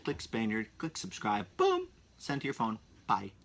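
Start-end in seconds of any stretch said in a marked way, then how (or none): 1.6-1.9 very high sudden burst; playful exclamation. Acted. To listeners finishing the subscribe steps, a high playful burst. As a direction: pop the word out like a punchline.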